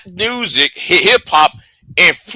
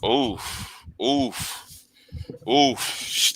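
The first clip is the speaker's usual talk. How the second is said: quiet, breathy oofs, lower; soft oof; 5 semitones lower, 13 dB quieter, much slower than usual. Retold or imitated. Streamer reliving the quiet burn, soft oofs. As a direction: wince it out softly. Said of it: "I'm just like".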